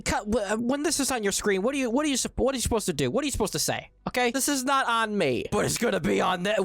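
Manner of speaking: ordinary talk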